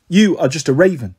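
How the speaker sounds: plain reading voice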